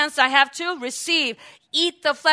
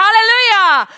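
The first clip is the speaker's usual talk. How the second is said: loud, higher hallelujah; joyful shout; 7 semitones higher, 11 dB louder than usual. Real, not acted. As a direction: lift the room with a loud cry. Preacher's exultant cry.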